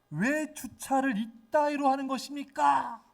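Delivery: high, drawn-out angry complaint; angry yelling. Retold or imitated. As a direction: act out a stranger yelling in outrage. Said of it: "소리를 막 지르는 거예요". (화내며 높게 길게 따지는 고함)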